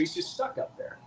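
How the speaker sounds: hushed, breathy aside; dramatic whisper. Acted. Telling the Brando story, whispering the predicament. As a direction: drop to a whisper on the stuck part.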